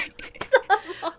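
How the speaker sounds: gleeful laugh. Real, not acted. Laughs at own mischief.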